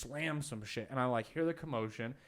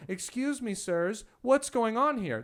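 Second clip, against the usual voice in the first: high, raised mock-formal voice; imagined polite bouncer; 9 semitones higher, 6 dB louder than usual. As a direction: put on an overly polite bouncer. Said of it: "none of these bouncers are gonna go".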